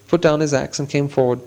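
plain reading voice

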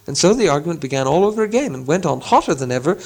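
ordinary delivery

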